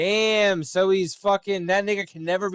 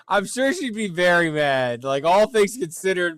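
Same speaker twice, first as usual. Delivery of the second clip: ordinary delivery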